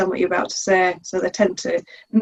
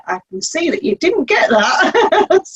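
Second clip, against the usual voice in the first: slow, laughing repeat; 6 semitones higher, slower than usual. Retold or imitated. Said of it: "literally say".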